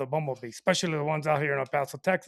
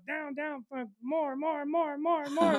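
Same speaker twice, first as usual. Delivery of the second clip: imitating wife; 8 semitones higher, 4 dB quieter, slower than usual. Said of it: "I just hear Audrey just".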